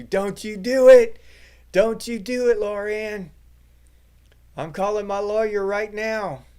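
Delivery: high, loud panicked-machine protest; screaming AI. Acted. AI screaming at its owner reaching for the cord, hammed-up scream. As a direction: yell it high, drawing out the threats. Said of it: "A I be screaming at you".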